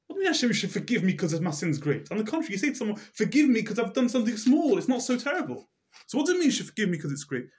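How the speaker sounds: can't make sense of it, voice climbing; puzzled questioning. Retold or imitated. Yankele questioning Hashem about the prayer, high and baffled.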